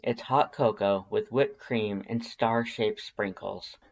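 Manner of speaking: ordinary delivery, nothing marked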